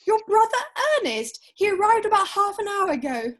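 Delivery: high, loud announcement; excited insistence. Acted. Niece insists on the surprise news to her uncle, excited announcement.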